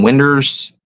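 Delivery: winders said WIN-ders, for windows; dialect pronunciation. Shown as a demonstration. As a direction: say winders with an er ending. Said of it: "for windows".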